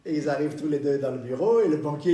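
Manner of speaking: ordinary talk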